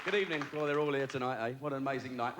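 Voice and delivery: ordinary delivery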